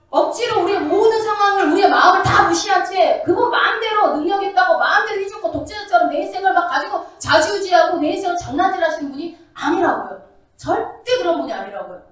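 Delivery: fervent preacher pressing the point; full-force preaching. Acted. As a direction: drive it home from the pulpit.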